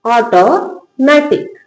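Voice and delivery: very slowly drawn out, syllable by syllable; teacher stretching automatic for the class to repeat; slow model word. Shown as a demonstration. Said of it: "क्या लिखा है?".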